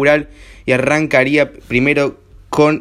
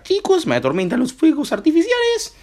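very high whimpering dog voice; mock dog voice; 13 semitones higher than usual. Joking about dogs and fireworks, squeaky dog voice. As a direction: speak as a frightened pet dog.

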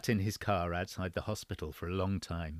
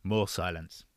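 plain reading voice

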